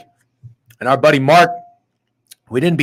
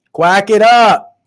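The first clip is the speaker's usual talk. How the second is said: slow, drawn-out higher jeer; slow mock taunt; 6 semitones higher, 9 dB louder, much slower than usual. Real, not acted. Taunting the Ducks again, slower and drawn out. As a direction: stretch the taunt out slow and high.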